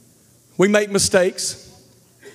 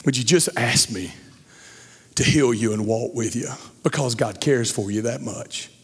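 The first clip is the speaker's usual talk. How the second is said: soft, lower pleading father; a touch of tearful pleading, softer; weeping dad; 5 semitones lower, 4 dB quieter than usual. Retold or imitated. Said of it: "literal dad weeping like".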